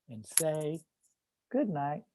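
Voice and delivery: very soft, gentle sign-off; hushed bedtime goodnight. Acted. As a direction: drop to a hush for bedtime. Poem ends at bedtime.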